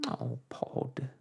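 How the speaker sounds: Homily's small whimpering plea, lightly voiced; a soft mother's whimper, gently played. A narrator's reading. Mother begging her husband, soft whimper. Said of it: "whimpered Homily".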